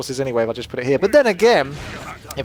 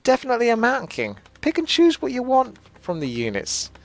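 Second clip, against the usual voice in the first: higher, drawn-out cartoonish read-out; playful character voice, pitched up; put-on message-reading voice; 6 semitones higher than usual. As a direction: ham up a viewer's message in a raised voice.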